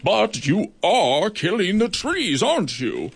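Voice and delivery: slow, weighty accusation; accusing judge. Acted. Goliath accusing the beetle, slow and stern. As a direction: press the charge.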